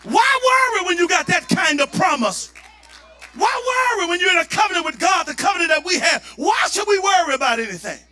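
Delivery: loud, high preaching climax; full force preaching. Real, not acted. Pastor exhorting his church in a loud preaching climax.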